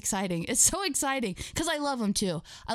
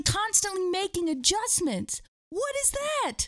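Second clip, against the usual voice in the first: slow, very high incredulity; theatrical high-pitched bewilderment; mock disbelief; 8 semitones higher, slower than usual. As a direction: stretch it out as if utterly baffled. Mock disbelief at coaching adjustments.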